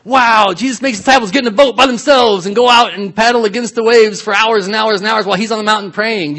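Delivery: high, loud mock enthusiasm; sarcastic wow. Preacher parodying wonder at the disciples' long paddle, sarcastic wow. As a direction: pile it up in one high run.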